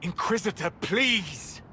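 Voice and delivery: high-pitched, urgent appeal; desperate plea. Acted. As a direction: beg for help, voice rising. Begs leader to save his friend.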